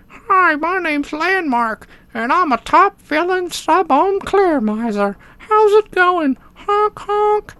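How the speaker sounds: very high cartoon tank voice; squeaky talking-toy character; silly clown. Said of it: "looks like a little smiley face".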